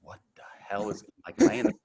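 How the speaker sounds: high, loud, broken-up disbelief; hammed-up alarm, pitch leaping up; mock bewildered panic. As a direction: act out a frantic reaction to something absurd. Acting out his shocked inner reaction.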